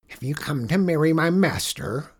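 loud odd goblin guard voice; a gruff-sounding goblin guard. Acted.

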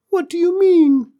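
very high, loud squeaky alien; tiny alien voice. Acted.

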